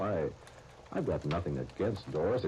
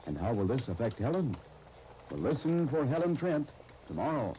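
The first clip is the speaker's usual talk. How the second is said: measured radio-serial announcer wrap-up; announcer narration; 4 semitones higher than usual. Acted. Announcer closing the serial for listeners, measured and dramatic. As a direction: tease tomorrow's episode to the listeners.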